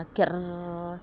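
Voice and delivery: door creak. A narrator's reading. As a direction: creak like a scary old door. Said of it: "दरवाजा उघडायचा आवाज आला".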